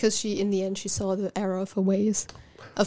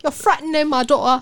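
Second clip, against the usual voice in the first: higher mock-British protest; British accent; 4 semitones higher, 3 dB louder than usual. Cohost echoing the trailer's father, in British accent. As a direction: imitate an indignant British father. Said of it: "have the redeemed British accent".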